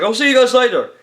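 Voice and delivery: tossed off like a hero walking away; cheeky Zack exit line. Retold or imitated.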